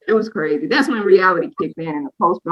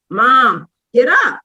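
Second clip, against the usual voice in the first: raised, louder plea to get up; urgent waking call; 4 semitones higher, 6 dB louder, much slower than usual. Retold or imitated. New mom waking her exhausted mother, urgent waking call. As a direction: call out to rouse a heavy sleeper.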